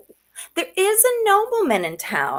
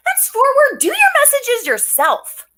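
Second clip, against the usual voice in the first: huffy; 9 semitones higher, 3 dB louder than usual. Acted. Nurse snaps at Juliet.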